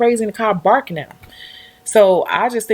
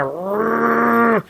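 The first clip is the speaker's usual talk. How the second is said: dog growl imitation; 3 semitones higher than usual. Imitating an aggressive dog's snarl, mock growl. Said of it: "it going".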